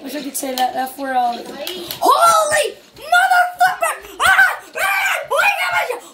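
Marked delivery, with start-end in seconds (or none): 1.6-3.9 high, drawn-out shout; explosive outburst. Real, not acted. Outburst mid-game. 4.2-5.2 high crow-like shrieks; crow-like scream. Real, not acted. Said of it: "Did I sound like a crow?". 5.3-6.0 very high-pitched frantic shout; frantic yelling. Real, not acted. Outburst mid-game.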